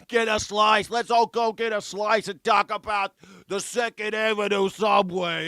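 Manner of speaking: high, loud mock New York accent; hammed-up New Yorker, stretching the last word; Donald Trump. Host riffing as the president wanting pizza, broad New York accent. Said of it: "like, gets his New York accent back".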